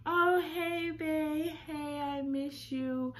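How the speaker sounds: lightly voiced, high and sugary lovey texts; mock-sweet "miss you" to the guy; sweet texting voice. Imitating his flirty texts to her, high and sugary. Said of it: "we back texting".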